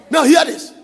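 loud call to the congregation. Real, not acted. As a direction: belt out the call to listen. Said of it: "preacher calling out".

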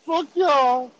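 high-pitched mock defiance; hammed-up taunt, high and cheeky; defiant cursing. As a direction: act out a cornered kid talking back. Acting his cursing at the parents.

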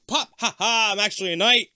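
high, loud, laughing mock villain; hammed-up gotcha with a laugh; goofy villain reveal.

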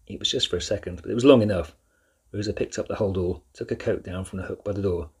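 ordinary delivery, nothing marked

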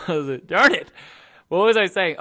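loud annoyed exclamation; frustrated outburst. Real, not acted. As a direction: blurt it out, annoyed. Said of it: "My sneeze threw me off".

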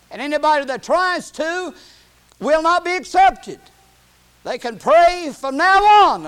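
high, loud pulpit preaching; impassioned preaching. Real, not acted. Preacher warning the church that only Jesus mediates, impassioned preaching. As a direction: drive it home, drawing out the key words.

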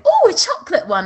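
high, quick excited squeal of interest; mock-excited imitation; imitated eager mum. Mum's eager reply, acted out.

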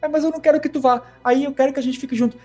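high, whiny voice; mock whiny girl. Queenie whining to Jacob, high and mocking. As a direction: whine it like a spoiled girl. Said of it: "Aí ele".

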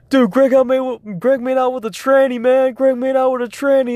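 high, loud, hyped-up imitation; excited gossip. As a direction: mimic a buddy spreading wild news. John excitedly tells his roommate, acted.

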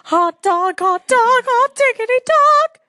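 very high, squeaky cartoon mouse; Mickey Mouse. Shown as a demonstration. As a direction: shout it like an excited cartoon mouse. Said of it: "Mickey Mouse".

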